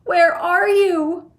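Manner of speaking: slow, high, loud anguished call; desperate cry. A narrator's reading. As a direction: search for him in despair. Mother panicking.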